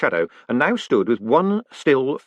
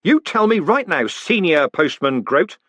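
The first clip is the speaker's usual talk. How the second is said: higher, louder snapped order, "Senior" slow; sharp command to the old man; 7 semitones higher, 4 dB louder, slower than usual. Acted. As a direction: bark the promotion at him. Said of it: "he snapped".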